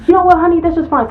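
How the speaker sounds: high, sugary mock brush-off; sassy customer. Customer dismissing the host's advice, high and sassy. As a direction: toss it off with fake sweetness.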